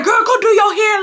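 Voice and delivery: high, yelling Wesley; hammed-up yell at her; mock yell. Wesley yelling at her in the house, high mock yell. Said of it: "he yelling in the house".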